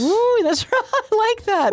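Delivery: high, laughing delight. Real, not acted. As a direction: squeal with delight. Host delighted by guest's answer.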